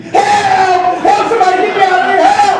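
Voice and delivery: high yelling cries for help; screaming for help. Retold or imitated. Man in gorilla suit clawed, screaming high for help. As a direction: yell it out, panicked, voice up high. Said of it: "he began to scream".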